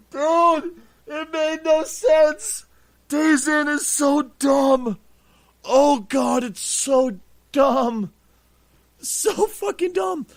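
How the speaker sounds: high, slow, laugh-strained squeal; helpless amusement. Real, not acted. Telling how the hotel was wired, cracking up in disbelief. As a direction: wheeze it out, overcome by laughter. Overcome by absurdity of the wiring.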